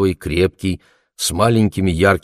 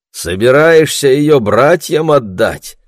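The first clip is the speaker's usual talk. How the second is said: high, loud, drawn-out jeer; mocking; 8 semitones higher, 7 dB louder, slower than usual. A narrator's reading. Bardusha calls after Andrei climbing the slope, mockingly. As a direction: toss it mockingly after someone walking off. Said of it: "насмешливо бросил ему вслед Бардуша".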